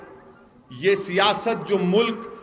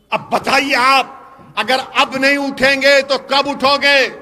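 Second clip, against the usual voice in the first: raised, driving orator's voice from a stage; fiery rally speech; 4 semitones higher, 7 dB louder than usual. Acted. Politician rousing a rally crowd, fiery full-force oratory. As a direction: hammer each line home to the crowd.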